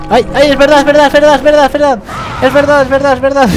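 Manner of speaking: high, loud laughing repetition; excited relief. Real, not acted. Gamer revives and laughs in relief, excited. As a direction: babble in giddy relief. Remembers his revive skill.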